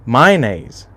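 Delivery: Mayonnaise said as "MY-nays", two syllables; demonstrating how he says Mayonnaise; his own pronunciation. Shown as a demonstration. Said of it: "I do not know why I say it like that".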